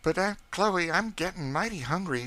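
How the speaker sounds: put-on high, eager boy's voice; young George voice. A narrator's reading. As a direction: beg hungrily for the cake.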